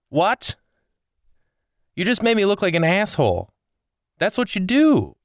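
high, incredulous outrage; indignant rant. Real, not acted. Comedian vents at friends who never showed, high indignation. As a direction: let the disbelief rise into anger.

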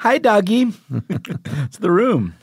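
Tommy Wiseau impression. Quotes The Room line.

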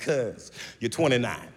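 a flat, slow putdown to a grown brother; deadpan put-down. Retold or imitated. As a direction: drop it dry and slow. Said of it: "I'm like".

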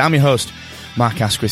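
ordinary talk

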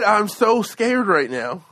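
slow, drawn-out mock-scared quote; mock tearful fear. Imitating the woman after she got punched, mock tearful fear. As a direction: play scared, taking it slowly.

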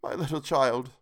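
mournful cry, understated; breaking down. A narrator's reading. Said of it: "He broke down all at once".

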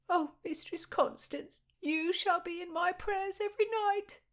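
high, gushing maid's voice; grateful gush. Acted. Maid thanks her mistress for the coins, high grateful gush. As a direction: overflow with thanks.

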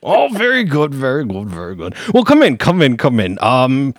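a pompous high-voiced lawyer flattering a client; pompous gentleman. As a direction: gush like a fussy wizard lawyer in a top hat. Lawyer character continues.

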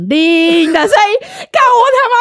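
high, loud, breathless telling of the sprint; excited retelling. Real, not acted. Telling her friend about sprinting for the train, loud and high with excitement. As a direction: relive the frantic dash.

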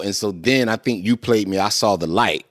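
ordinary talk

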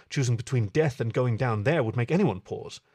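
plain reading voice

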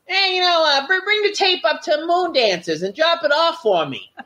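high-pitched imitation of a wrestler giving orders; Levy. Retelling a wrestler's request to drop off a tape, high put-on voice. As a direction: put on his voice, pitched well above your own. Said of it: "And Levy says".

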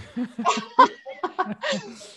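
high burst of real laughter; bright delighted laugh; big laugh. Real, not acted.

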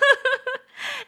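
laughs. Real, not acted. Laughing at own skit.

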